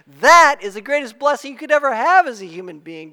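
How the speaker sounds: loud, declamatory sermon delivery; forceful preaching. Acted. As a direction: proclaim the verse with rising force.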